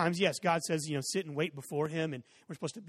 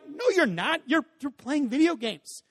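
high, louder rebuke, slowing down; exasperated dad; 8 semitones higher, 5 dB louder, slower than usual. Retold or imitated. Dad rebutting son's claim, high and exasperated. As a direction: spell out the obvious.

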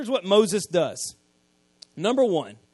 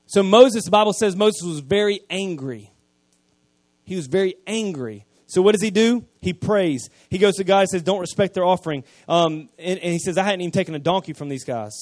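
louder preacher's voice driving the story; preaching at full force; 8 dB louder than usual. Acted. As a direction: preach it out to the whole church.

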